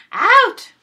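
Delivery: very high, very loud screamed out; furious shout. Acted. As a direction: blast it at her. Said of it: "shouted Señora Micaela".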